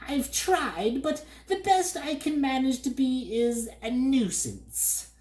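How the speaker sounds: high bird voice, self-pitying; mopey bird. A narrator's reading. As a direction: sigh it like a failed demon bird. Bird figure.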